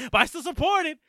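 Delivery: loud, high, insisting; emphatic. Real, not acted. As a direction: insist loudly and eagerly. Insisting he still supported.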